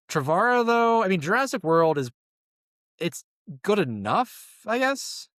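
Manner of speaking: doubtful, pitch rising high; skeptical, unimpressed. Real, not acted. Skeptical about Trevorrow.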